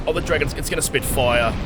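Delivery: ordinary talk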